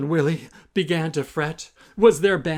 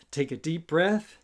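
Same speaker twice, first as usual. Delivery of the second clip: ordinary delivery, nothing marked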